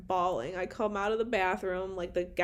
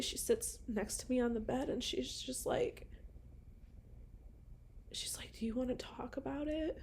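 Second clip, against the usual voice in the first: unvoiced soft whisper; 4 semitones higher, 9 dB quieter than usual. Real, not acted. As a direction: hush it gently. Tender moment with nurse, whispering.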